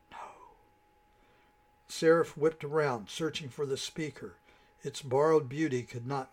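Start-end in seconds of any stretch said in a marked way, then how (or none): 0.1-0.6 a hushed, commanding whisper; barely audible whispered command; soft whisper. A narrator's reading. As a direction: breathe the word as a firm order. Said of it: "a soft whisper commanded".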